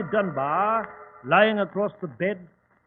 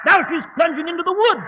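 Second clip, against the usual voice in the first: higher and louder alarmed cry; excited call; 9 semitones higher, 9 dB louder than usual. Acted. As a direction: call out as she vanishes. Chasing witch, calling out.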